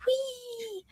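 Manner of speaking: high, soft squeal; little squeal of glee; playful "whee". Real, not acted. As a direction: let out a tiny high-pitched whee. Playful squeal before laughing.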